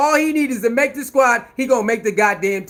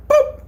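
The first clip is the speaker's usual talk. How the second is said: boop sound effect; 3 dB louder than usual. Retold or imitated. In Living Color sock hit.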